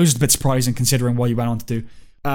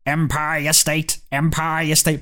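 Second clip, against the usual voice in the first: slow, stretched robotic chant; Dalek; much slower than usual. Retold or imitated. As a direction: intone it like an alien killing machine. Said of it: "I really wanted them to go".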